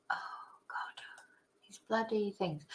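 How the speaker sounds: hushed, half-whispered grumble to self; muttered aside. Real, not acted. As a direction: grumble under your breath, barely audible. Grumbling to self over hard names.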